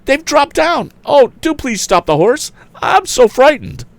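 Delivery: high frightened pleading. A narrator's reading. Frightened lady in runaway wagon.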